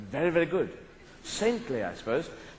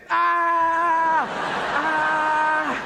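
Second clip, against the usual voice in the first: panicked screaming; 8 semitones higher, much slower than usual. Pretend. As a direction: shriek as bees cover you. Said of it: "their footsteps were like this".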